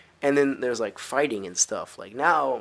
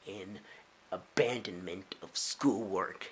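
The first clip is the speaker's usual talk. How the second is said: ominous refusal; 4 semitones lower, 7 dB quieter, slower than usual. Pretend. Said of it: "I'm just like".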